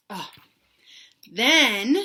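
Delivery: ordinary delivery, nothing marked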